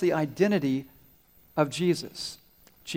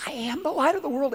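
prompting again; 9 semitones higher than usual. Retold or imitated. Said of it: "she tries it one more time".

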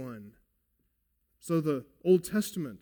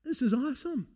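excited Peter; 4 semitones higher, 3 dB louder than usual. Acted. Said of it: "He's saying here".

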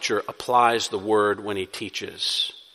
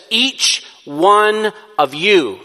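Each one of you slow, high and loud, every word hit; pointed each one of you; 8 semitones higher, 7 dB louder, much slower than usual. Acted. Preacher stresses Paul's words to the church, slow and pointed. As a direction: lean hard on Each, slowly. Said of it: "he actually says".

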